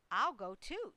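plain reading voice

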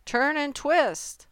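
high, drawn-out honk; high goose call. A narrator's reading. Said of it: "honked the goose".

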